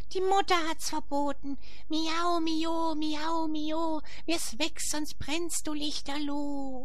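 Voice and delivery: very high, thin warning cat voice. A narrator's reading. The cats warning Paulinchen, high cat voice. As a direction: raise the pitch way up and wag a paw. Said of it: "Sie drohen mit den Pfoten".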